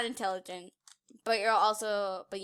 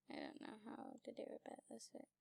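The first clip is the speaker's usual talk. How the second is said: fading whisper; 23 dB quieter, much faster than usual. Real, not acted. Trails into whisper.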